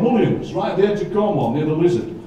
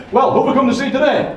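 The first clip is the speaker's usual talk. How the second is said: compère call-out; 6 semitones higher than usual. Shown as a demonstration. Poet rousing the audience before the song, excited call-out. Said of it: "So I go".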